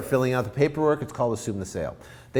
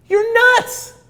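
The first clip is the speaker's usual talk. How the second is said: squeaky high scoff of disbelief; much higher mock-outraged retort; outraged seller; 7 dB louder than usual. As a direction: throw the word back at the buyer. Seller balks at lowball offer.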